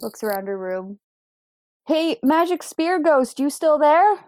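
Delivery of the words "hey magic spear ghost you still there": raised, higher call to someone unseen. Acted. Player's character calls to the magic spear ghost, calling out. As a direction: call out to check if anyone's there.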